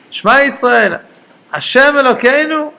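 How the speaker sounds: high, loud declaration of the verse; emphatic recitation. Acted. Reciting the Shema verse aloud.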